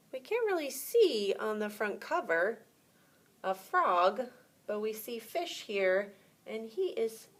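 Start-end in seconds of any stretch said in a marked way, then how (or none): none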